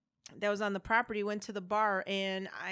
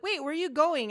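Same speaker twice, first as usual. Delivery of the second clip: higher and louder, a guy caught off guard as she leaves; mock surprised call; 7 semitones higher, 7 dB louder than usual. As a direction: call after her in hammed-up shock. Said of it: "And he's like".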